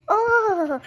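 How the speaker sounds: high, loud ironic oh; wife scoffing loudly at her husband; indignant oh. Acted. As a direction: scoff at the accusation.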